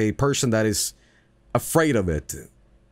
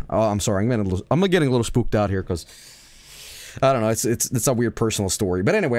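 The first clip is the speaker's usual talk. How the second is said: ordinary delivery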